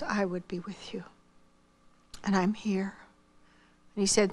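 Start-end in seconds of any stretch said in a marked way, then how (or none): 0.0-3.0 whispered comfort. Retold or imitated. God's comfort retold.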